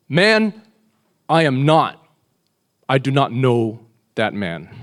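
loud, forceful protest, slowing at the end; emphatic denial. Acted. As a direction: deny it hard, word by word. Peter's emphatic denial, acted.

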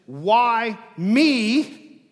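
"me" hit loud and drawn out; emphatic, loud weight on "me"; stress on me. Shown as a demonstration. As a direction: punch the word "me". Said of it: "The emphasis here is not on the persecution".

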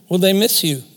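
ordinary talk

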